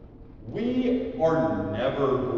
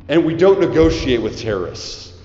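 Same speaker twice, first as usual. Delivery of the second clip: loud slogan; 8 dB louder than usual. Acted. Preacher giving students a slogan, loud and punchy. Slogan before call-and-response.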